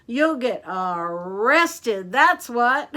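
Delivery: loud, slow, drawn-out threat; shout. A narrator's reading. Mister Dart corners the sneak thieves, shouting. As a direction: stretch out the word for the crooks. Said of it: "shouted Mister Dart".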